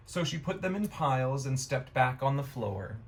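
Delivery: ordinary delivery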